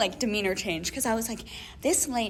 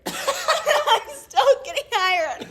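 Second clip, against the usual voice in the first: laughing through the words, much higher; 13 semitones higher, 8 dB louder, much slower than usual. Real, not acted. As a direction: crack up while saying it. Laughing at own absurd story.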